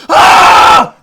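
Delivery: high, loud angry scream. Retold or imitated. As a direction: scream it as if arguing on the phone. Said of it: "I just hear screaming".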